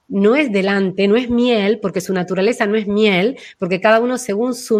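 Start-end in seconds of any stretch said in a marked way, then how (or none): none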